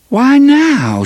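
high, loud outburst; burst out. Acted. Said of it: "she burst out".

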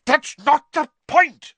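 slow, high, loud fury; raging. A narrator's reading. Father dismissing his sons' excuses, raging. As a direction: rage it out word by word. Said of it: "raged Mister Weasley".

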